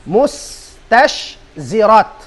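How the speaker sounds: mustashzirat spelled out slowly, syllable by syllable; slow careful pronunciation. Shown as a demonstration. Teacher drilling the class on mustashzirat, slow and careful. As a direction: draw out mustashzirat so students hear each sound. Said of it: "pronounce niyo kuno marayaw".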